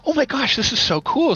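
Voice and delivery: high-pitched retold wonder; excited kid. Retelling childhood awe at TV voices.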